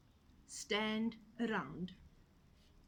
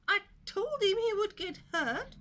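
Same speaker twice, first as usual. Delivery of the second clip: high, loud told-you-so hen; smug hen; 12 semitones higher, 8 dB louder than usual. A narrator's reading. Hen saying she warned him, high and loud. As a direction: cluck it smugly. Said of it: "said the hen".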